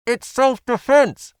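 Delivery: high, loud, slow indignant woman; arguing back. A narrator's reading. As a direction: insist defensively. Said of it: "she tried to argue back".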